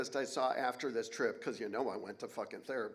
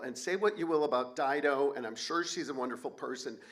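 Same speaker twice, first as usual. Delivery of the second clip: ordinary delivery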